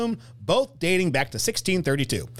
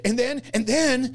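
put-on drawn-out, higher kid voice, slow; excited kid rambling; 7 semitones higher than usual. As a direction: stretch it out like a kid stalling. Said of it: "exactly how a kid would say it".

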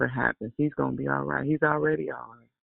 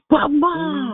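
high, loud pleading cry; pleading son; 7 dB louder than usual. Retold or imitated. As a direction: voice of a worried son, pushing back. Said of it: "And I'm just as calm".

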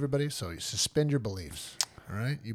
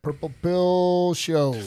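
announcer; 6 semitones higher, 6 dB louder, much slower than usual. Acted. Show name announced slowly.

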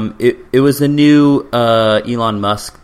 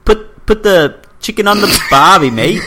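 loud, hammed-up Australian accent; mock Australian accent; 5 semitones higher, 6 dB louder than usual. Shown as a demonstration. Podcast friend doing a voice, mock Australian accent. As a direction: do a broad Aussie.

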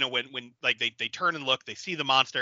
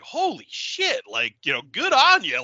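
excited; 6 semitones higher, 4 dB louder than usual. Retold or imitated. Jay and Bob cheer the monster, mock excited. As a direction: marvel at the monster's win. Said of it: "they're all excited".

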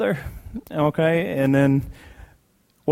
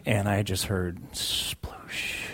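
sploosh; 4 semitones lower, 9 dB quieter, much slower than usual. Retold or imitated. Imitated splash sound in story.

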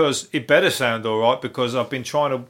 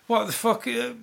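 higher, incredulous outburst; disbelief; 10 semitones higher than usual. Real, not acted. Podcaster reacting to the vegetable-sharing story, higher incredulous blurt.

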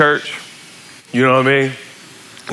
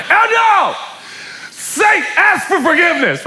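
a dad hollering at his son; yelling at son; 12 semitones higher than usual. Retold or imitated. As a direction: bellow his nickname to get him over.

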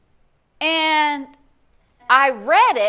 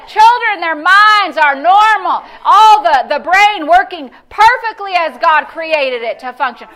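louder, driving sermon call, slow and pounding; preaching at full force; 5 semitones higher, 8 dB louder, slower than usual. Acted.

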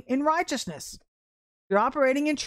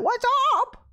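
mock-upset, high and far from own voice; upset young lady; 10 semitones higher than usual. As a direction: squeal it like a flustered barista. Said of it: "all upset".

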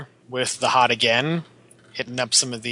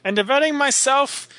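put-on high, lofty old scholar voice; Isaac Newton; 11 semitones higher than usual. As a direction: recite it like a grand old scientist. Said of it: "some Isaac Newton".

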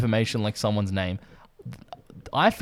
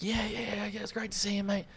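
mock friendly catch-up; 10 semitones higher, 8 dB quieter than usual. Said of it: "when you're like".

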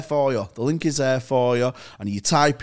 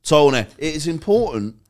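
ad read; 6 dB louder than usual. Acted. Sponsor read opening.

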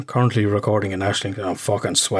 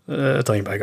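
normal talking voice

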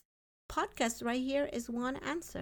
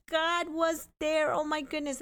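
amazed joy; 7 semitones higher than usual. Retold or imitated. Retelling her own story, overwhelmed with joy at God. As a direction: marvel at it, almost tearing up. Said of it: "I'm like".

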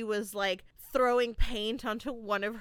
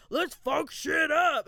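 drawn-out, high, loud battle cry; mock rowdy shout; 5 semitones higher, 5 dB louder, slower than usual. Walter suddenly turning on the plan, mock rowdy shout. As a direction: holler it slowly like a reckless troublemaker.

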